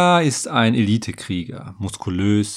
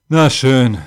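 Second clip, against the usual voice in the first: louder, reluctant giving-in; sighed consent; 6 dB louder than usual. A narrator's reading. Warrior agrees to tell the story, sighing. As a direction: give in with a touch of a sigh. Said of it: "seufzte er".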